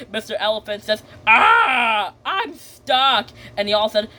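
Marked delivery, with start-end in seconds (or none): 1.3-3.3 shouted "ah", then higher complaint; mock alarm. Mister Elephant falls into the trap, shouted mock alarm. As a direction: cry out as if suddenly trapped.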